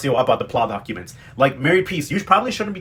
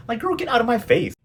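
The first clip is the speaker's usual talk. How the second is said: sassy clapback; 9 semitones higher than usual. Real, not acted. Talking back at villain.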